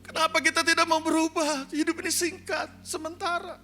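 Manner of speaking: very high, insistent preaching cry; impassioned pleading sermon. Real, not acted. Preacher urging the church to change because life is short, impassioned plea. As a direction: plead at a high pitch, starting loud.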